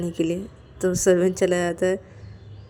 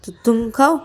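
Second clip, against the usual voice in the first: slightly raised, pushy comeback; mild retort; 4 semitones higher, 5 dB louder than usual. Acted. Payal tells Ananya she is hungry too, mildly indignant. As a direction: turn it back on her, lightly indignant.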